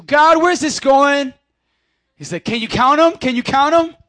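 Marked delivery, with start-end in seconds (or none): 0.0-1.3 exasperated outburst. Retold or imitated. Abraham losing patience counting stars. 2.4-4.0 emphatic challenge. Retold or imitated. God challenging Abraham.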